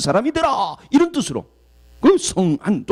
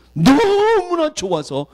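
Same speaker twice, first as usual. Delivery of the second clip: overjoyed; 8 semitones higher, much slower than usual. Real, not acted. Overjoyed, then repeated.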